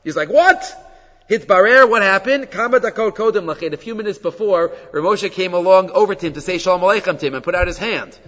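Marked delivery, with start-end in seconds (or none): 0.3-3.7 man realizing he tipped the rabbi; shocked what. Retold or imitated. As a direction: blurt it out in shock. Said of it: "he was shocked".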